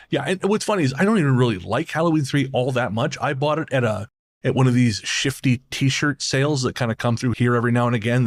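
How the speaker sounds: ordinary delivery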